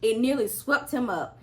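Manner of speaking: usual speaking voice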